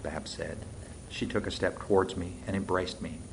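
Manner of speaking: ordinary delivery, nothing marked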